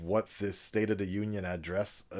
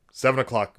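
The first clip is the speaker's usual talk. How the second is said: loud, formal, announcer-style address voice; mock presidential address; 7 dB louder than usual. Vlogger parodying a presidential speech to viewers, grand and formal. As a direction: play a head of state addressing the nation. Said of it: "I don't know why I keep doing this accent".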